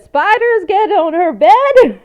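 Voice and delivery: very high, loud, drawn-out disbelief; shocked shriek. Retold or imitated. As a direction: shriek it in hammed-up horror, breaking into laughter. Acting out shocked reply to doctor.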